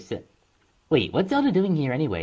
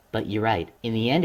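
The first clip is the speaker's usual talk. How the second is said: ordinary delivery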